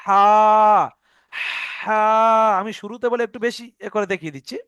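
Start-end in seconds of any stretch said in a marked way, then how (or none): 0.0-2.0 হা pushed out as a breath from the throat; breathy ha demo. Shown as a demonstration. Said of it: "কিরকম?".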